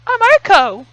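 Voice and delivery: very high, nervous awkward greeting; high-pitched shy girl; awkward high sporty girl. Acted. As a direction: blurt out an awkward hello. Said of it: "What's a good voice".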